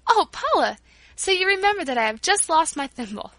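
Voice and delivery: delighted cry, high and loud; jubilant. A narrator's reading. Girl thanks Paula for a new thimble, jubilant. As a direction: exclaim with joy, pitched well up. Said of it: "I cried jubilantly".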